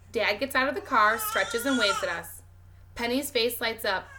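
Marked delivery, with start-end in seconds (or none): none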